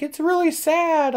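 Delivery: high, drawn-out mocking imitation of a crying girl; mock weepy girl. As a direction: mimic her sadness in a slow, high voice.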